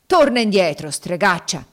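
angry command. Acted. Master calling the witch back from the stairs, angry. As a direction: shout after her to come back. Legree orders Cassie back with insult.

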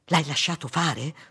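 plain reading voice

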